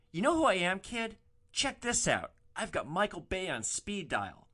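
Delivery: mock a man bragging about his connections; show-off passenger. Passenger shows off, acted.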